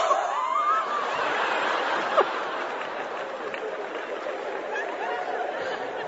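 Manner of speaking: breathless squeal of laughter; helpless silent laughter; high wheezing laugh. Real, not acted. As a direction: laugh till no voice comes out. Audience reaction to the punchline.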